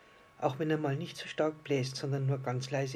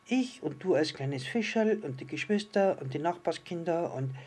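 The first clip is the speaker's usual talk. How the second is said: higher, eager little-star voice; impatient little star; 4 semitones higher, 4 dB louder than usual. Acted. Little star names all the children impatiently, higher and eager. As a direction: list them eagerly as the small star.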